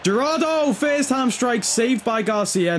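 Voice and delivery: high-pitched burst, easing down as it's saved; live commentary on a sudden chance; excited shot call. Real, not acted. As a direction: cry out the name, then settle through the save.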